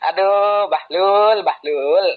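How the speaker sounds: loud, high taunt at a fool; exasperated jeering cry. Real, not acted. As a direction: cry out Aduh, Bahlul in high jeering disbelief. Exasperated insult at the opponent.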